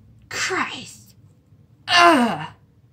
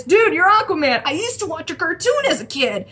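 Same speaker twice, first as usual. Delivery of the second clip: higher, mock starstruck fan; excited fan gush, lightly acted; imitated freak-out; 7 semitones higher than usual. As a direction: act out a fanboy freaking out. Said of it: "he freaks out".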